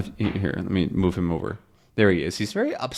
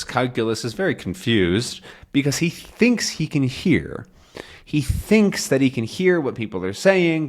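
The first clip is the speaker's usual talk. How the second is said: ordinary delivery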